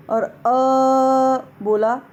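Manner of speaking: अ held for three full beats, slowly; slow drawn अ, three measures long; three-beat vowel. Shown as a demonstration. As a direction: stretch अ out long as pluta. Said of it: "त्रिमात्रिक भी कह सकते हैं".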